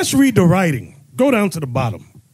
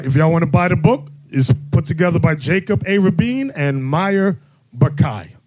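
ordinary talk, nothing marked

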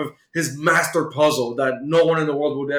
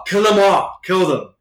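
mock shouted order; 4 semitones higher, 4 dB louder than usual. Villain orders his men to kill.

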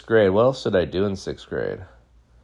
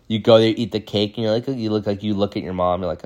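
ordinary delivery

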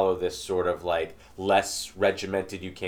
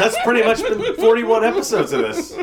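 high, loud teasing through laughter; laughing teasing; 11 semitones higher, 6 dB louder than usual. Real, not acted. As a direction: rib her while laughing. Teasing co-host while laughing.

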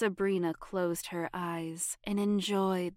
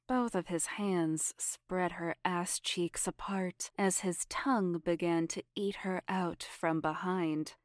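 plain reading voice

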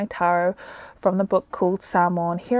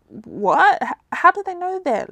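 startled; 10 semitones higher than usual. A narrator's reading. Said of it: "Losi wondered".

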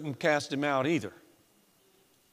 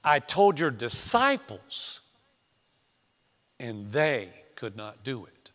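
very slow, punching "disciples" and "they"; emphatic restatement; 3 semitones lower, slower than usual. Shown as a demonstration. Preacher showing where the father's emphasis falls, pointed stress. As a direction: stretch "disciples", then set "they" apart. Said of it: "Get the difference there and the emphasis".

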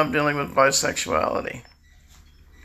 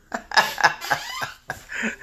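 a real high burst of laughter; bright burst of laughter, breathy and unforced. Real, not acted. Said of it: "And we started laughing".

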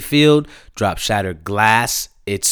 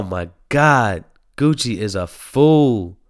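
normal talking voice

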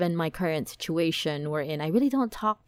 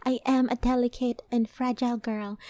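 mock delicate girl voice; 5 semitones higher than usual. Mock dainty self-description.

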